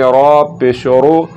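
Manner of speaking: slow "रा पेश रो" with heavy "रा"; heavy, drawn-out "रा पेश रो"; full, thick R. Shown as a demonstration. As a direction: stretch it out, thickening the R of "रो". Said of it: "मोटा करके पढ़ेंगे".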